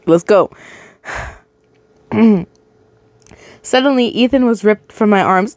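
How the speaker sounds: ordinary delivery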